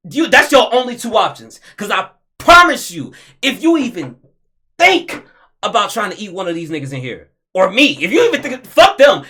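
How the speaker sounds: loud, high, furious rant at the screen; angry yelling. Real, not acted. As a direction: chew them out, full volume.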